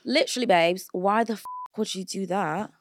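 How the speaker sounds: put-on playful London accent. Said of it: "that London accent is really good".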